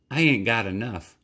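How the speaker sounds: sheepish boy, lightly voiced; boy's voice. Bryce admits to Marlene he has no money, lightly voiced boy. Boy tells waitress he's broke.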